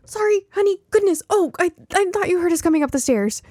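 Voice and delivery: high, loud flustered mother voice; a mother apologizing in a fluster, high and loud; flustered mom. A narrator's reading. Mom who startled her daughter apologizes in a high flustered voice.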